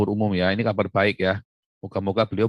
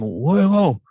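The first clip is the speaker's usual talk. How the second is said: mock insult; 6 semitones higher, 3 dB louder, slower than usual. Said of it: "nggak boleh saya ngomongin begitu".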